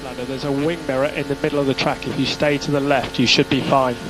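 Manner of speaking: ordinary delivery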